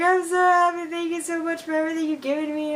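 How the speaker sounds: high mock-tearful gushing; imitated happy crying. Thanking the principal for everything, mock-crying with joy. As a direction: start loud, blubber gratefully, trail into blahs. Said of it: "even crying to her".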